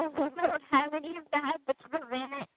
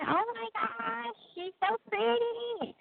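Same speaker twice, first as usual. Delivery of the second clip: gushing compliment; 8 semitones higher, slower than usual. Retold or imitated. Daughter imitates people's compliments.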